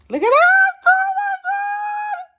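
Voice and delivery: very high, loud squeal; excited shriek. Real, not acted. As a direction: shriek it, stretching the words out. Shrieking over her table reveal.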